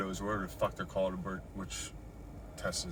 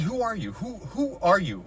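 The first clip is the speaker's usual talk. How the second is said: demanding who he is; 10 semitones higher, 6 dB louder than usual. Retold or imitated. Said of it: "I said".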